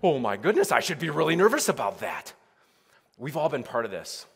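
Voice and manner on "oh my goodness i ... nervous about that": loud mock alarm, as if struck by sudden worry. People suddenly realising they should worry, loud mock alarm. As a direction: blurt it out like a sudden panic.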